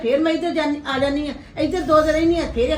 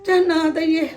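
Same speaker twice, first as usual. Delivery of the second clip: weeping; 2 semitones higher than usual. Acted. Mother weeping about her sons.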